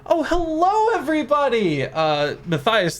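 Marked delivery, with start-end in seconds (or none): 0.1-1.8 very high, loud fairy hello; fairy greeting the whole party, very high and loud. Acted. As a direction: sing out the hello like a tiny fairy. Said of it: "steps up and goes".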